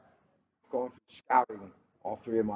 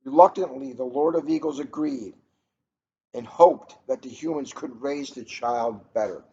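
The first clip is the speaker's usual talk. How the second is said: measured storybook reading, a bit brighter and louder than chat; story narration; 4 semitones higher, 6 dB louder than usual. A narrator's reading. Host reading a picture book to listeners, storybook narration. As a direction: read the tale aloud to listeners, carefully paced.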